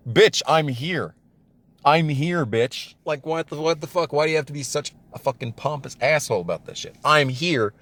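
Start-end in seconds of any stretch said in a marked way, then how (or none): none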